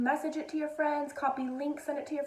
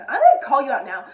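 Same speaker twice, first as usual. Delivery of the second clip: much louder playful scolding; calling out; 13 dB louder, faster than usual. Real, not acted. Scolding her viewers for not watching, much louder. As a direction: raise the voice to call them out.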